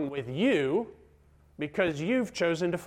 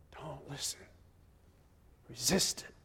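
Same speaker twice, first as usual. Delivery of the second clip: very soft, near-whispered counsel; hushed urging; 8 dB quieter than usual. Real, not acted. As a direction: urge them quietly to resist. Preacher counters the accusing voice.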